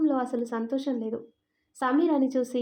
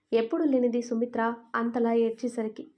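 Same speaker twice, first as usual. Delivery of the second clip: ordinary delivery, nothing marked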